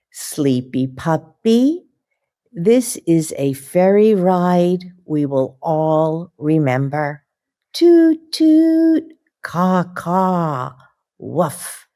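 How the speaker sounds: lively, slow picture-book reading with puppy noises; reading to children. Acted. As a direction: read it like bedtime story time for little kids.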